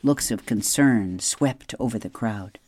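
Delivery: plain reading voice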